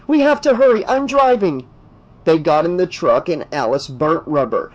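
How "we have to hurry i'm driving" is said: high, louder hurried call; urgent shout. Acted. Alice rushing Dan into the truck, urgent shout. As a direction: push him to move now. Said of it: "she shouted".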